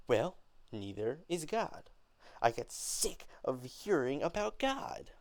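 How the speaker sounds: mock God with rising fury; angry and mocking. Beneatha scorning God in front of Mama, angry. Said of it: "talking down on God".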